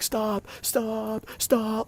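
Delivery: very high pitched imitated scream of stop; mock screaming wife. Wife screaming at husband to stop, high mock shriek. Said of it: "at the top of her lungs".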